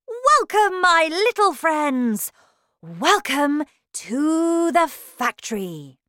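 high, loud showman's welcome; gleeful cry. A narrator's reading. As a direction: greet the children grandly, stretching the last words. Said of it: "Mister Wonka smiled at the five children and cried".

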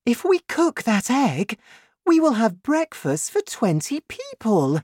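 high-pitched, excited woman character; high voice of an excited mum; mother's voice. A narrator's reading. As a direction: pitch it well up, marvelling at the egg. Mother marvels at giant egg.

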